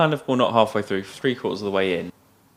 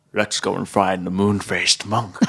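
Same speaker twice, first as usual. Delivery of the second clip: Sean Connery impression. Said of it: "this is my favourite line".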